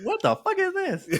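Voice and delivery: very high squeaky disbelief; mock bewilderment. Retelling sitting baffled in the theater, squeaky disbelief. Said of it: "but I was like".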